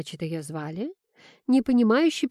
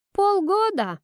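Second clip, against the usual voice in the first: much higher, louder exclamation; pointed correction; 12 semitones higher, 5 dB louder, slower than usual. A narrator's reading. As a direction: pipe up with a high, emphatic correction. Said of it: "уточнила Кира". (звонкое, громкое уточнение)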